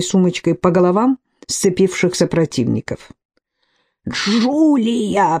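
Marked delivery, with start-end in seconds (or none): 4.1-5.4 wheezed. A narrator's reading. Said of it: "просипел полковник".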